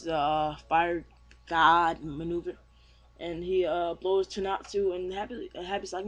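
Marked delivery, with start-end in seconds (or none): none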